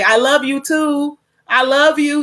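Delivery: slow, emphatic "I love you", every word full; drawn-out, deliberate declaration of love; truly said. Shown as a demonstration. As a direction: say "I love you" fully and mean it, pitch lifted. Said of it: "just truly just say it".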